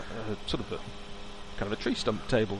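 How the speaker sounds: normal talking voice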